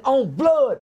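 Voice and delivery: ordinary talk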